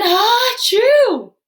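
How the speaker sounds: high, loud explosive sneeze; huge sneeze. Acted. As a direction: let the sneeze burst out. Big sneeze.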